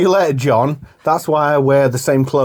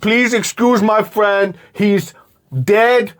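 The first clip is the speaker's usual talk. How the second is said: high, slow, emphatic delivery; film hero impression; 7 semitones higher, much slower than usual. Host insisting on how the hero says it, slow and high. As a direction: space it out and punch "dead". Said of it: "He's like".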